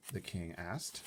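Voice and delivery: plain reading voice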